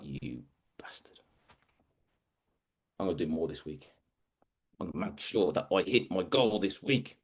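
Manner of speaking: near-whispered oath rising to low, soft determination; low and hushed, talking to yourself; resentful resolve. Retold or imitated. Worker stung by his mentor's words, quietly seething into resolve.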